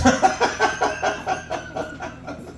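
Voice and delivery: very high squeal of a laugh; squeaky high laugh. Retold or imitated. Comic showing upstate talk as a very high squealing laugh. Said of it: "up here we talk like this".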